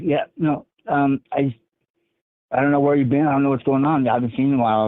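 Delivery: lightly voiced wary brother. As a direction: play the suspicious brother a bit up. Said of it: "And he's like".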